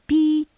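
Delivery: high, loud voiced whistle; sharp high "pii" like a station whistle; imitated whistle blast. A narrator's reading. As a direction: mimic the guard's whistle. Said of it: "と吹きかけますと".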